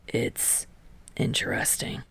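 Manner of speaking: near whisper; hushed. A narrator's reading. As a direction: keep it under the breath. Kageyama's line, whispered.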